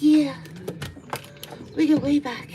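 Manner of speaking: loud, playful cat reply; cat's voice. Pretend. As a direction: speak up for the cat, pitched high.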